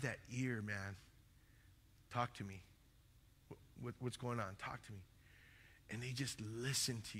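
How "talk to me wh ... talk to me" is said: soft, lowered, listening voice; gentle invitation. Retold or imitated. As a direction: quietly invite them to open up. Attentive leader inviting someone to talk.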